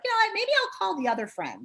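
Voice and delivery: fed-up brush-off. Retold or imitated. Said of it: "That's like".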